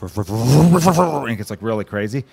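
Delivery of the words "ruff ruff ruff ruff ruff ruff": dog bark building to a frenzy; crescendo bark. Retold or imitated. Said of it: "it's got this crescendo it hits".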